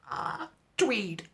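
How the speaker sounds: soft, high swooning toff murmur; breathy mock-posh reverie; mock posh sigh. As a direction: sigh it like a dreamy aristocrat. Dreamy mocking vision.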